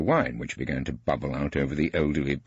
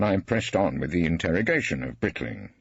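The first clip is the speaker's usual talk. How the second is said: ordinary delivery, nothing marked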